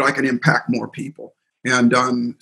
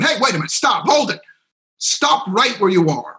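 much higher, urgent call to halt; commanding stop; 6 semitones higher than usual. Retold or imitated. Speaker stopping a walking-out seminar crowd, retold commanding call. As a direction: hold the room, halt them mid-step.

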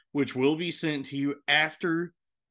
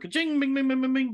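cash register jingle; 11 semitones higher, much faster than usual. Pretend. Network jingle repeated.

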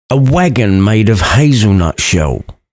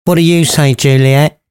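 mother voice; 5 semitones higher than usual. A narrator's reading. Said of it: "asked her mother".

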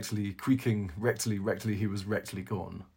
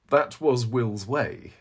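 defensive; 4 semitones higher, 7 dB louder, slower than usual. A narrator's reading. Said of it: "John Eglinton defended".

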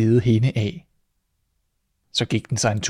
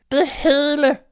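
frightened squeak; 3 dB louder, slower than usual. Acted. Mouse's last word before eaten.

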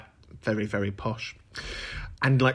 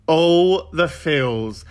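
very slow, drawn-out gush; gushing drawl; 3 semitones higher, 4 dB louder, much slower than usual. Acted. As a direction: stretch out every word for effect. Gushing repeat, drawn out for effect.

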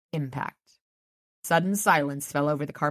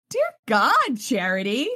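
startled exclamation; 6 semitones higher, 4 dB louder, slower than usual. Acted. Narrator voicing Harry, caught off guard by Charity's jab. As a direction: jump up high and loud in shock.

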